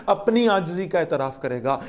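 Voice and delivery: ordinary talk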